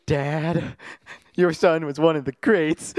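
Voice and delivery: a hint of an earnest young hero, slowed; Ezra Miller line. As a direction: deliver it earnestly, a bit slow. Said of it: "the Ezra Miller's question".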